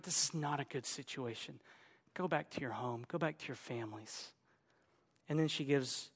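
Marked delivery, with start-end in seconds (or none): 0.0-4.3 resigned dismissal. Acted. Naomi telling the girls to go back home, quiet and resigned. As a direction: send them away quietly.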